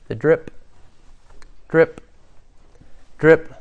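each drip set apart by long pauses; dripping. Acted. Preacher sets a dungeon scene, slow spaced dripping. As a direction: say drip like slow, separate water drops.

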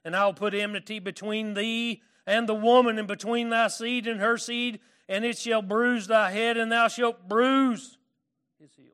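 preaching scripture. Acted. Reads Genesis aloud.

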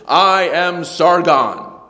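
loud, high and very slow decree; kingly proclamation. Acted. King opening his edict, proclaiming grandly. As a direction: announce it like a royal edict. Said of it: "All kings would begin their edicts".

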